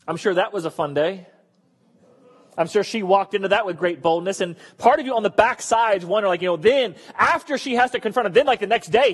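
usual speaking voice

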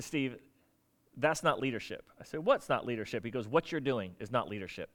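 low, quiet imitated rebuke; understated retold reprimand; calling out. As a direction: correct him softly and firmly, imitating a mentor. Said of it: "he was calling me out".

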